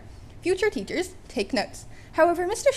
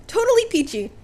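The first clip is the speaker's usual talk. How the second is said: nervous fanboy; 8 semitones higher, 7 dB louder than usual. A narrator's reading. Midoriya panicking fanboy.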